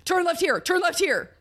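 high, repeated word for word, same tune; robotic, anxious repeat. Shown as a demonstration. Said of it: "anxious robot voice".

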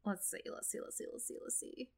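muttered to self. Real, not acted. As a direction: mumble it to yourself. Muttering while searching.

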